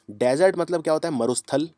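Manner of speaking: ordinary delivery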